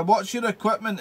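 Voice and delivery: ordinary delivery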